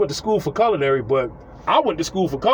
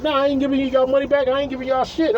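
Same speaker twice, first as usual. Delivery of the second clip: high, put-on voice of a stubborn woman; imitating her flat refusal, pitched up; defiant neighbor voice; 6 semitones higher than usual. The neighbor refusing the customers a refund, higher defiant voice. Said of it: "the chick was like".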